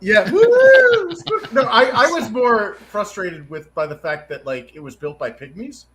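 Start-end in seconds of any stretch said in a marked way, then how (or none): 0.3-1.1 high, loud joking "woo"; a playful spook's wail; mock ghost woo. Guest jokes about haunted inn, high loud ghostly whoop.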